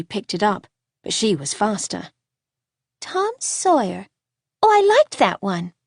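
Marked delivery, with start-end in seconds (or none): 3.0-5.7 put-on high, bright-eyed child's voice; delighted little girl. A narrator's reading. Little girl spots the boy's book, delighted and high. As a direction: exclaim like a pleased child. Young Layla finds his book.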